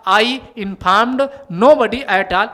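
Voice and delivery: slow, deliberate sentence reading; slow example read. Shown as a demonstration. Teacher showing the alternative sentence slowly. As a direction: lay the sentence out word by word. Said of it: "अगर यहाँ did not ना होता".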